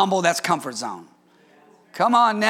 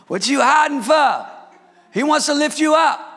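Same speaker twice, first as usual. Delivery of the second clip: preaching; 6 semitones higher than usual. Acted. Preaching the song line.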